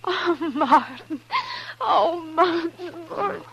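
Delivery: laughing through tears, voice high and cracking; overjoyed sob-laugh at seeing her brother; tearful joy. Acted. Sister reunites with long-lost brother.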